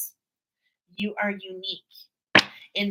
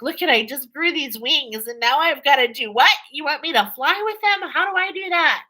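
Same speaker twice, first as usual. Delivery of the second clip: startled newbie flyer; 7 semitones higher, 7 dB louder than usual. Pretend. Voicing someone newly grown wings.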